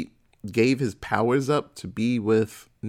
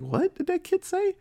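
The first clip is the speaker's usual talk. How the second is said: confused townsperson. Retold or imitated. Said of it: "And they're like".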